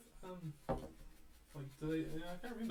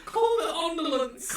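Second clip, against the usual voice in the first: laughing shout; 13 semitones higher, 9 dB louder than usual. Pretend. Repeating the joke, laughing.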